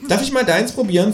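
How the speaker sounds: high, cheeky animal voice; kangaroo voice. Acted. Kangaroo asks to taste the narrator's food, high. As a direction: ask sweetly for a bite.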